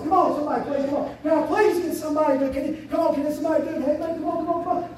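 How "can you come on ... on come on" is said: normal talking voice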